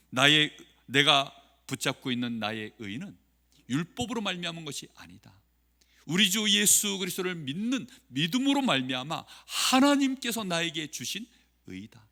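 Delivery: normal talking voice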